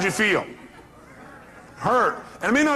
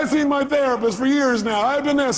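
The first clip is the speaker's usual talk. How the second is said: high-pitched mock complaint; protesting caller; 9 semitones higher, 3 dB louder than usual. Caller defending herself to the counselor, high mock complaint. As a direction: imitate a caller piling up excuses. Imitates caller listing failed remedies.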